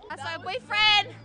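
normal talking voice

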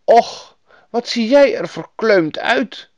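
high, concerned woman's voice; Lapland woman. A narrator's reading. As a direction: fuss over a frozen child. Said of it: "zei de vrouw tegen Gerda".

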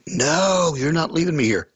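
high-pitched retold refusal; imitated high, insistent protest; protesting woman. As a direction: mimic her wailed "no", pitched well up. Retells woman refusing to be left.

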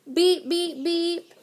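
high-pitched toy truck voice; little truck crying out. A narrator's reading. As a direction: beep and plead like a small stuck truck. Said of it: "cried the little blue truck".

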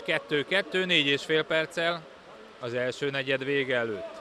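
ordinary talk, nothing marked

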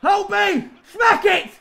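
high-pitched shout to a player; excited high yell; urgent calling out. Real, not acted. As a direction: urge the striker to shoot. Urging player to shoot.

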